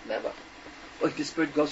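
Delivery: usual speaking voice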